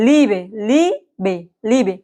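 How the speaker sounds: লিবে slowly, with long "ee". Shown as a demonstration. Teacher repeats the example for learners, long-vowelled লিবে. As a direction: draw out the first vowel of লিবে. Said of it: "এটা হলো লম্বা ই".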